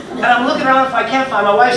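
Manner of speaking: normal talking voice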